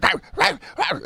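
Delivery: high yappy mock barking "row, row"; dog bark imitation. Imitating the dog barking at the apartment. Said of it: "you just hear sport be like".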